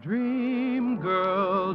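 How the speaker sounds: usual speaking voice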